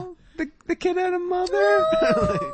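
high sappy coo. Retold or imitated. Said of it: "you're like".

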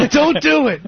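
high pleading cry; frantic pleading cop. Pretend. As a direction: beg them not to do it, high. Mock hostage standoff.

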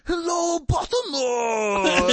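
put-on high, slow Japanese accent shout. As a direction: call out to the crowd in a Japanese accent. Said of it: "he was like".

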